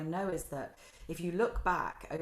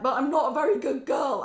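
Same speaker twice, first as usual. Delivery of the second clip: higher, louder put-on silly voice; mock husband voice; 6 semitones higher, 9 dB louder than usual. Imitating her husband answering her, higher and louder. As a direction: ham up the husband's reply. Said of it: "and he'll like".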